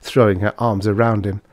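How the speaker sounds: plain reading voice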